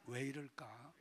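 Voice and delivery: hushed, low self-questioning; remorseful lament. Shown as a demonstration. Preacher leading the congregation to repeat, quiet remorseful lament. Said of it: "한번 따라서 합시다".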